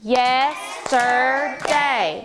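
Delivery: Yesterday stretched very slowly, syllable by syllable; slow model word. Shown as a demonstration. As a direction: drag Yesterday out slowly for the class to echo. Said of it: "Echo after me".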